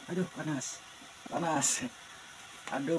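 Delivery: drawn-out high pained yelp; ouch, it's hot. Real, not acted. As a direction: cry out at the burning bun. Touching the hot steamed buns.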